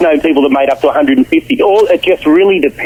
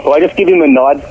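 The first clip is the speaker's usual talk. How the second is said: ordinary talk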